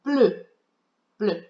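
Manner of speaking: bleu with a rounded French eu vowel, said twice; French pronunciation. Shown as a demonstration. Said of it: "or in French".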